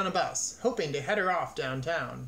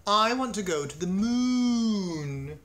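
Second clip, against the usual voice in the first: a cow's slow, swelling declaration; grand announcement; 4 semitones higher, 4 dB louder, slower than usual. A narrator's reading. Martha the cow tells her farmer her dream, announcing it grandly. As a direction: announce it high, loud and drawn out. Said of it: "Martha announced".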